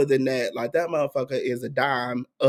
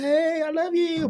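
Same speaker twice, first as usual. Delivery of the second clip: high, sugary fake friendliness; sweet-talking to her face; 14 semitones higher than usual. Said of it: "I'm like".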